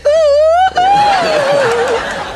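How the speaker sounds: repeated high, loud hoots; imitated attention-getting noise. Prince calling Chris from behind, high imitated hoots. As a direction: mimic insistent piercing oohs. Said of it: "I just heard this noise".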